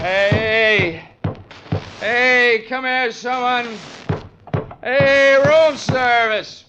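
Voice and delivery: loud, high shouts through the door slit; hollering for attention from a locked cell; calling out. Acted. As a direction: yell for someone to come.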